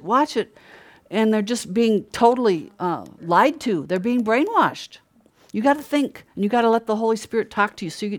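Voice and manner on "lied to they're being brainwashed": ordinary talk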